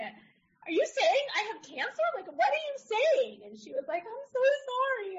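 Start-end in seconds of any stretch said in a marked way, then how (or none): 0.0-3.4 high, rising, half-laughing alarm; retelling her own frantic disbelief; much higher, frantic questions with a laugh; mock panic. Patient panicking at cancer news. 4.0-5.2 high, tearful apology; a young doctor about to cry; much higher, weepy "so sorry"; near tears. Retold or imitated. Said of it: "kind of starts to cry".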